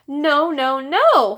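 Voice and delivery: loud, high panicked no; shouting child. A narrator's reading. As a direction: yell it clutching his rear. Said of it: "Fudge shouted".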